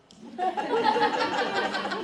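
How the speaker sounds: a shrieking laugh, high and loud; loud high burst of laughter. Real, not acted. Big laugh.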